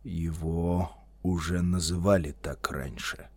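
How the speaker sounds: low, soft, wary remark; hushed, guarded wizard; grave murmur. A narrator's reading. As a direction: say it quietly and deliberately. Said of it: "проговорил он".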